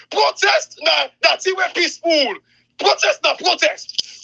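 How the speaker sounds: high and emphatic, slowing on the last protest; emphatic insistence. Real, not acted. Insisting protest need not be peaceful.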